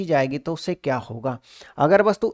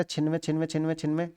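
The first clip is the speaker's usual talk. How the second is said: softer, trailing off under the breath; murmured counting; 2 semitones lower, 8 dB quieter, faster than usual. Real, not acted. Teacher checking his sum, murmuring the number to himself. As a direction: mutter the number to yourself.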